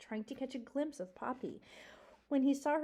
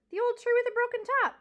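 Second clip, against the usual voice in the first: loud, fast, high porcupine voice; 12 semitones higher, 9 dB louder, faster than usual. A narrator's reading. Porcupine explaining to the mouse, loud, high and fast. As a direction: bark it out fast as the old porcupine. Aerith voiced loudly in story.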